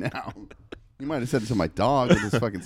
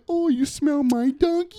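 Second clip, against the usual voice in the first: high, slow, louder cooing to a dog; mock doggie talk; 5 dB louder than usual. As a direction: imitate gushing over a sniffing dog. Mimics what everyone says to dog.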